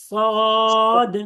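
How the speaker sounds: সদ said with the mouth rounded, heavy and full; round-mouthed heavy Saad. Shown as a demonstration. Teacher models Saad for the class, mouth rounded and heavy. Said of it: "আবার বলেন".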